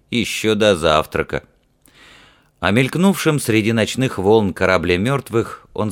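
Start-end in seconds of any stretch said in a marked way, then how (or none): none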